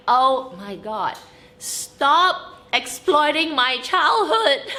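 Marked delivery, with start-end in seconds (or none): 1.6-4.7 slow, loud, laughing protest; hammed-up indignant wail; mock outrage. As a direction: cry out in playful outrage. Mock outrage at brand copying.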